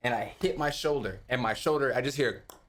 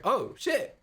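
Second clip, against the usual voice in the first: amazed relief; 10 semitones higher than usual. Retold or imitated. Shoulder pops back in.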